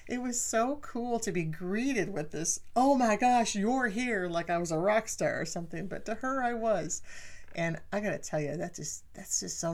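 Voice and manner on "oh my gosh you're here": loud, high delighted welcome; excited greeting. Retold or imitated. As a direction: welcome her like a rock star. Mother greeting like rock star.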